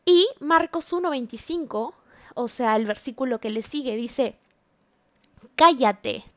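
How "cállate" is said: a loud, sharp order to be silent; Jesus shouting the spirit down; commanding shout. Acted. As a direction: silence the demon with one loud word. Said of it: "y le ordenó".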